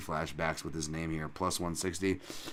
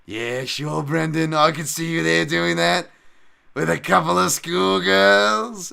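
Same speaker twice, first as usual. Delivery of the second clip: louder, higher British voice, slowing at the end; Michael Bisping; 8 semitones higher, 11 dB louder, slower than usual. Retold or imitated. Commentator teases his co-host, loud sly British voice. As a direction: tease with a suggestive British lilt. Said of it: "Bisping ... He was just like".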